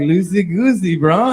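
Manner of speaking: high, breezy echo of loosey-goosey; chill bro. Retold or imitated. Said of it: "I'm like".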